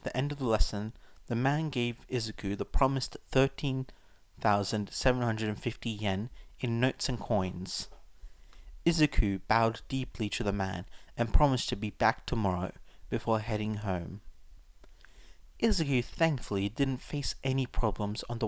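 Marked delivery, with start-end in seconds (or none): none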